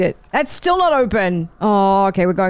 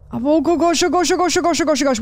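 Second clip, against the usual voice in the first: high, breathless-sounding run of repeats; panicked babbling; 5 semitones higher than usual. Real, not acted. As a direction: babble in a panic as something approaches.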